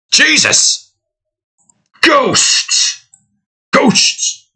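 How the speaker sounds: mock fright. Spooked by his own ghost sound.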